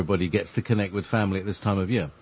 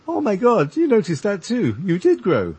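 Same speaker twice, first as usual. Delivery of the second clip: imitating a stunned mother, much higher and louder; shocked mum; 10 semitones higher, 4 dB louder than usual. Son retells his astonished mother, high and loud. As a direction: squeal it like your mum just saw you grow. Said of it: "and she said".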